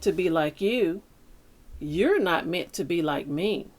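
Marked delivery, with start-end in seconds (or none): none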